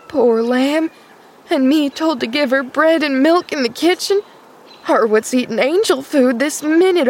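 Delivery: high-pitched, distraught country servant; agitated, high wailing complaint. A narrator's reading. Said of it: "she wailed".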